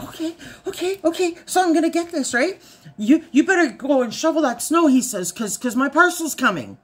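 high, rushed bossy voice; excited husband. Retold or imitated. Wife imitates husband ordering snow shovelled, high rushed. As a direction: hurry her along, giddy.

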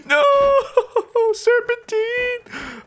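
high, stretched-out squealing cry; mock panic. Gamer fleeing gunfire, laughing mock panic. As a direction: wail it slowly in hammed-up terror.

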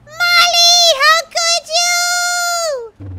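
very high, loud drawn-out wail; betrayed wail. Pretend. Daisy, just killed by sister Molly, betrayed wail. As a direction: wail the last word long in mock betrayal.

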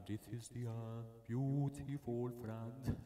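low, very slow, drawn-out parody; mocking singer impression. Teasing the singer of the album, slow low parody. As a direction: stretch each word out, low and mock-solemn. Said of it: "I'm making fun of".

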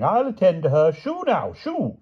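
high, dotty shooing; commanding. A narrator's reading. King handles the beast, commanding in high voice. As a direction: take charge and shoo. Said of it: "commanded the king".

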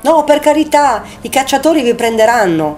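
high-pitched, pleading alarm; alarmed warning. A narrator's reading. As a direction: plead with them, voice jumping up. Urgent warning about the hunters. (avvertimento allarmato, voce acuta)

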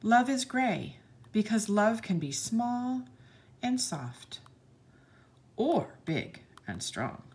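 put-on bright, sing-song read-aloud for children; lilting picture-book narration; storybook reading. A narrator's reading. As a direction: read the page like to a class of little kids.

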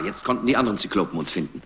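ordinary talk

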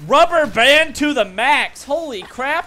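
slow, high, loud exclamation; stretched-out cry of disbelief; astonished shout. Real, not acted. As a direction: yell it out word by word. Reacting to lag in game.

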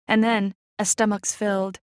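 plain reading voice

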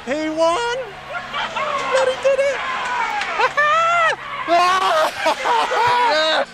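ecstatic shouting. Real, not acted. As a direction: scream with joy after winning. Driver celebrates win over radio.

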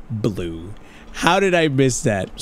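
ordinary talk, nothing marked

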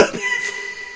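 laughter trailing off very high and soft; high squealing laugh. Real, not acted. Fan overcome by the song, high squealing laughter. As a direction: break into a thin, squeaky laugh. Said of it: "I'm laughing".

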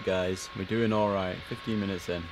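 usual speaking voice

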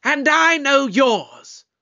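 high, loud and slow; defiant comeback. Acted. Standing up to villain's threat.

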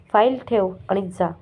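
plain reading voice

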